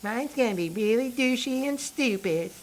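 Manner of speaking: retry of the friend impression, high and mocking; impression of Faith. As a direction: pitch way up, teasing a friend. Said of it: "That's my impression".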